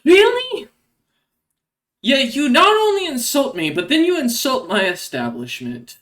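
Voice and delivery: high, loud indignant outburst; offended Shar. Acted. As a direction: start with an incredulous high cry, easing toward the end. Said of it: "Shar kinda walks over and goes".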